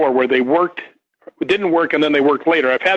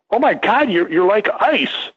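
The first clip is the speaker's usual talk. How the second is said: a startled woman's voice, much higher; surprised girl; 6 semitones higher than usual. Retold or imitated. Girl touching his forehead, a high startled mock gasp. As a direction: gasp it out like a shocked young woman. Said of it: "She goes".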